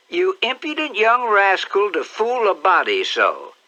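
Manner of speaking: high, loud mock-scolding; aunt pretending to be cross; fond scolding aunt. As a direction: scold him while hugging him. Aunt Sally scolds fondly.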